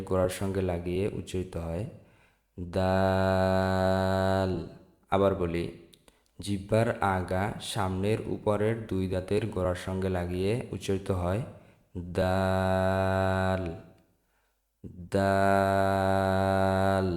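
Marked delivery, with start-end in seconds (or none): 2.8-4.5 দাল stretched very long and held level; long drawn-out letter. Shown as a demonstration. Teacher drawing out দাল for learners to copy. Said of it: "দাল উচ্চারিত হয়". 12.2-17.1 দাল stretched very long and held level; long drawn-out letter. Shown as a demonstration. Teacher drawing out দাল for learners to copy. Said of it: "আমার সাথে সাথে পড়বেন".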